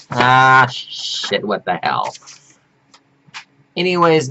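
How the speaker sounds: ordinary talk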